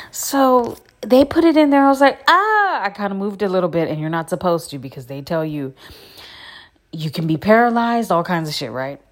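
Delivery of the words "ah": sharp high cry of pain; a sudden high yelp as the needle hits. Retold or imitated. Retelling the epidural needle going in, sharp high yelp. Needle goes into her spine.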